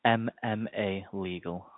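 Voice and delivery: slow, each letter spelled out; slow and clear. Real, not acted. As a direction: spell it out slowly for the caller. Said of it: "who are you calling from, sorry?".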